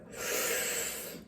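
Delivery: wordless savoring exhale; long sigh. Real, not acted. Eater trails off describing the ice cream's ending, sighing. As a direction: let out a long, soft, airy sigh.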